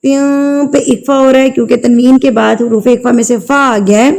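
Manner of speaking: ordinary talk, nothing marked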